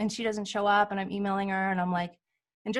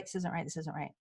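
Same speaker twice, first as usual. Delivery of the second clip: much lower and softer, insistent murmur; hushed inner voice; 6 semitones lower, 12 dB quieter than usual. Retold or imitated. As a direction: repeat it quietly to yourself.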